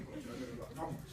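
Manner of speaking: ordinary talk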